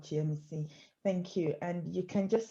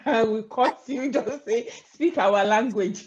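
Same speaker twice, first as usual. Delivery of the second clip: playful stern warning, louder, higher; mock threat; 4 semitones higher, 9 dB louder than usual. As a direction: tease her with a fake threat, loud and bossy. Host playfully threatens guest.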